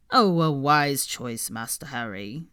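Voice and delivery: slow, solemn elf voice; serious house-elf. Acted. Creature approving Harry's choice, slow solemn elf. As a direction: nod it out gravely, slowly. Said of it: "nodding seriously".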